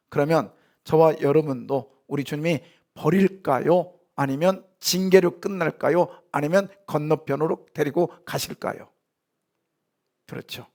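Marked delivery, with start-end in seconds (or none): none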